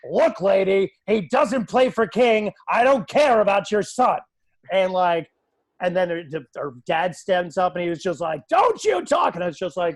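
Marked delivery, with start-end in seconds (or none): 0.1-4.2 loud, raised-pitch heated comeback; angry retort. Retold or imitated. Retelling his heated comeback to mother. 8.5-9.3 high, loud outburst; angry dad. Retold or imitated. Acting angry dad standing up.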